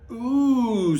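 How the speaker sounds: thrilled by the secret; excited ooh. Acted. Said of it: "said the heralds".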